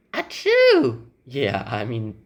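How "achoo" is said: high-pitched fake achoo; mock sneeze. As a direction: act out a big sneeze. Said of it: "Just don't sneeze on the test".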